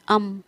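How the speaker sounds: "अम" as a separate "um", starting on a short vowel; first sound isolated. Shown as a demonstration. Teacher asks what sound it gives, first sound isolated. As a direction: sound out "अम" alone, firmly. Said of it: "sound कैसी दे रहा है".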